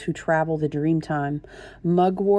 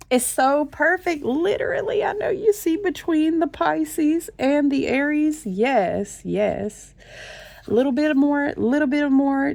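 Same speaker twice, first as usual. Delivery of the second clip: excited; 9 semitones higher than usual. Real, not acted. Excited over astrology reading.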